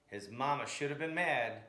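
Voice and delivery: plain reading voice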